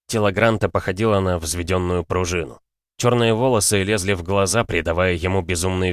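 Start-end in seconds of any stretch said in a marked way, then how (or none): none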